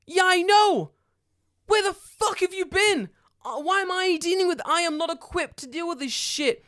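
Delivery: high, loud exasperated yelling; panicked yell. Retold or imitated. Yelling at the neighbour who started the fire, panicked and loud. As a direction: shout it at him in disbelief.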